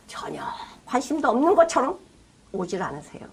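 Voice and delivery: hushed breathy whisper. Real, not acted. Preacher whispering to the congregation that Jesus seems not to care. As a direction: lean in and whisper it.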